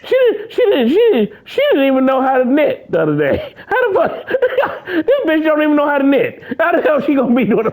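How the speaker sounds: high, laughing incredulous rant. Real, not acted. Mocking Daisy Ridley while cracking up, laughing. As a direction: laugh through the disbelief.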